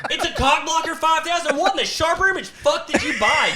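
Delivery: very high-pitched sarcastic rant; exasperated outrage. Retold or imitated. Husband outraged by wife's ovulation ring, exasperated. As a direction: rant incredulously, voice way up.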